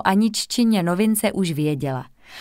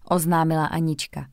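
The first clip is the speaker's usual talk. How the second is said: usual speaking voice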